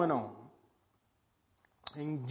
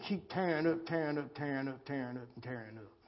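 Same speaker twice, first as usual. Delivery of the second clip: each tearing up softer and lower until almost gone; fading repetition; 5 semitones lower, 10 dB quieter, faster than usual. Acted. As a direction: let the list die away to nothing. Preacher repeating troubles, dying away.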